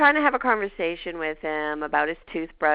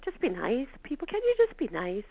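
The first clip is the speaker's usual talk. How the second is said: exasperated plea; 5 semitones higher than usual. Acted. Pleading with people to be nice.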